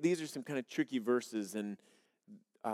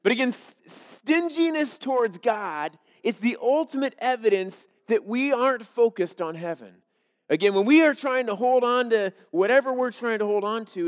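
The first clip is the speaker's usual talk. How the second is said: louder, driving preacher voice; forceful preaching; 6 semitones higher, 8 dB louder than usual. Acted. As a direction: press each line home to the congregation.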